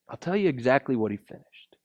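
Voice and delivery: fast hushed whisper; a preacher's confiding whisper; whispered secret. Real, not acted. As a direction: lean in and whisper the reveal.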